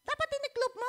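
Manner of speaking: high, pointed scolding imitation; imitating wife's tone. Preacher imitating his wife's correcting tone, high and pointed. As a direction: play the wife correcting him, sharp and high. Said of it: "Kasi ang kanyang tono".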